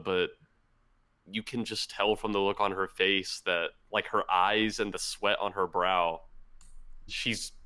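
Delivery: ordinary talk, nothing marked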